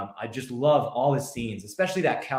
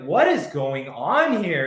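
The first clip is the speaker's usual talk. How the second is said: baffled, higher and louder acted line; Justin Theroux; 4 semitones higher, 5 dB louder, slower than usual. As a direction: act out a director losing his grip, slowly. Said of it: "Justin Theroux's like".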